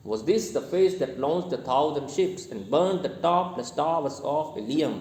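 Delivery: slow scanned verse reading, every second syllable stressed; iambic rhythm. Shown as a demonstration. Teacher showing how the line is read, slow iambic recitation. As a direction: recite in a steady da-DUM beat, landing on FACE, LAUNCHED, SHIPS. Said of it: "ऐसे पढ़ा जाता है इसको".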